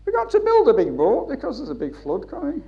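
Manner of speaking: put-on high, loud Scottish accent. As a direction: do Noah as a Scot with a broad accent. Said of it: "he's got a Scottish accent saying".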